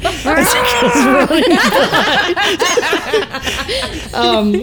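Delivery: slow, high laugh; laughing through the words. Real, not acted. Laughing about screaming camel.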